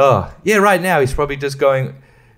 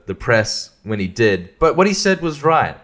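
usual speaking voice

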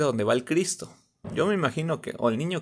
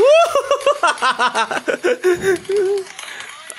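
long, high cackle; loud laugh; 5 dB louder than usual. Real, not acted. Viewer reacting to the tumble, loud high laughing. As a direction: crack up loudly and keep it going.

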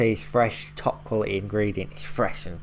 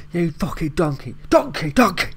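loud mock chef yell rising higher; Gordon Ramsay shout; 8 semitones higher, 4 dB louder than usual. Reactor repeating the Gordon Ramsay donkey insult, building mock yell. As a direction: hammer the word donkey like an angry chef. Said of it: "so many shows go around calling people donkey".

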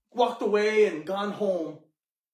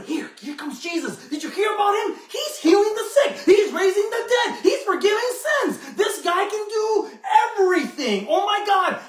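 high, loud, laughing gossip about the healer; breathless crowd chatter, pitched way up and laughing; excited crowd; 11 semitones higher, 5 dB louder than usual. Acted. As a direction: burst out with thrilled, laughing amazement. Crowd spreading news of Jesus.

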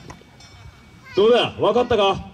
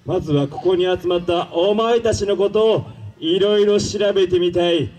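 ordinary talk

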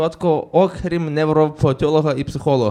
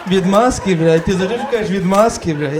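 nagging mother; 3 semitones higher than usual. Retold or imitated. Mother scolds son for his excuses, exasperated. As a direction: scoff like a fed-up mother.

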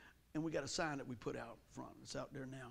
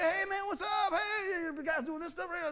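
loud, much higher hammed-up buddy greeting; an overeager guy not knowing whether to bump or shake; mock greeting; 12 semitones higher, 8 dB louder than usual. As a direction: play the confused friend at the handshake. Acting out awkward greeting.

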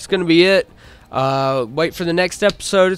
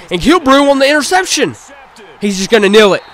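high, loud burst on the interception; loud sportscaster thrill; excited play-by-play call; 5 semitones higher, 8 dB louder than usual. Real, not acted. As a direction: call the pick with a rush of excitement. Calling an interception live.

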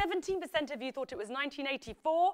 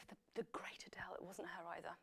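breathy whispered aside; 5 semitones lower, 13 dB quieter, faster than usual. Real, not acted. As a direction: whisper it to yourself. Whispered aside about [name].

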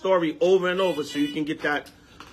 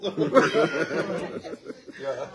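soft laugh; 5 semitones lower, 4 dB quieter than usual. Real, not acted. Laughing at [name] story.

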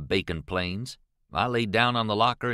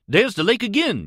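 acted high, loud cry of discovery; excited yell; 11 semitones higher, 6 dB louder than usual. A narrator's reading. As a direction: call it out excitedly, pitched right up. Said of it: "yelled Jim just then".

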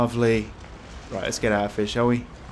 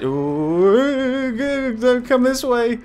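scared horror game streamer; 11 semitones higher, much slower than usual. Real, not acted. Hiding as enemy approaches.

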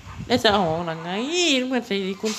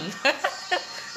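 laughs; 7 semitones higher, 7 dB quieter than usual. Real, not acted. Laughing at a remark.

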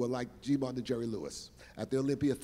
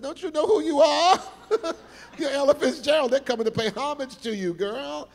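much louder and higher, laughing through it; excited encouragement; 13 semitones higher, 9 dB louder than usual. Retold or imitated. Reassuring nervous [name], loud and laughing. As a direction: cheer her on with disbelief.

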